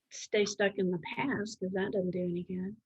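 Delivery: usual speaking voice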